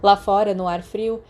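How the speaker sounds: plain reading voice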